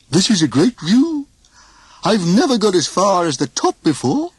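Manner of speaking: high wondering gosh; amazed Goofy. A narrator's reading. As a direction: marvel at it, dopey.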